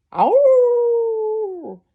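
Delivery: long, high, sustained howl; loud wolf howl. A narrator's reading. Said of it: "With a loud".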